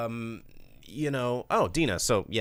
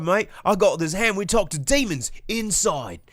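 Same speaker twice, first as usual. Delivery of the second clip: higher, louder hammed-up Australian accent; mock Australian accent; 10 semitones higher, 6 dB louder than usual. Joking about Aussie kids staying inside, bad Australian accent. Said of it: "I don't know that that was Australia".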